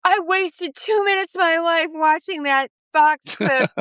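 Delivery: high-pitched imitation of an outraged commenter; mock angry yell. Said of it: "then they yell at me".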